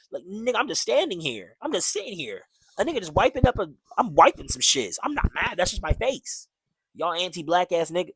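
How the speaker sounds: high-pitched, worked-up mock protest; exasperated retort. Fed-up worker answering people who say he looks mad, exasperated.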